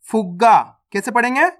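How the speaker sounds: normal talking voice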